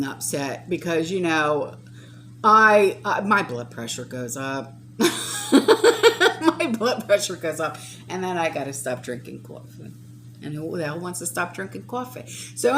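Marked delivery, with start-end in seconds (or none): 5.0-7.8 slow, laughing at herself. Real, not acted. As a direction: chuckle through it, slowly.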